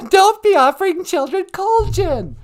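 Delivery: high, loud laughing objection; laughing protest. Real, not acted. To her husband about the gin joke, laughing and loud. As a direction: scold him through laughter.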